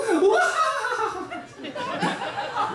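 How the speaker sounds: high cackle of an androgynous anime villain; evil anime villain laugh. Retold or imitated. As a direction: cackle like a cartoon evildoer. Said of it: "she laughs like some androgynous evildoer".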